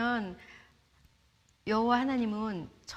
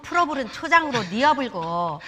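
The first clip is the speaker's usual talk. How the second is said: loud, hammed-up Jeolla dialect psalm; 5 semitones higher, 13 dB louder than usual. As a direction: recite it in thick Jeolla dialect, laughing through it. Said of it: "전라도 버전". (전라도 사투리로 크게 웃으며 시편 낭송)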